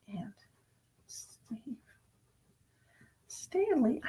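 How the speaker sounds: soft whisper of names read off the screen. Real, not acted. Psychic whispers the names of regular viewers. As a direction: whisper each name as you spot it.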